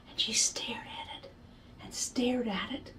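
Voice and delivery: soft unvoiced whisper; hushed. A narrator's reading. Storyteller hushing for the picture moment, whispered. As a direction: draw listeners in with a whisper.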